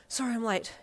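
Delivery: puffing from running; panting after a run; out of breath. Acted. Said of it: "you puffed".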